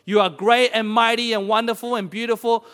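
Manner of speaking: loud praising prayer to the Lord; fervent prayer. Acted. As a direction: lift the praise up with conviction. Leads closing prayer.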